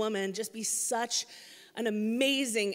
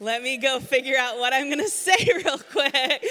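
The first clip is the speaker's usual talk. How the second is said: real laughter breaking through the words; laughing; 2 semitones higher, 6 dB louder than usual. Real, not acted. Telling the congregation about the late prep, laughing. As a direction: laugh through it, amused at the rush.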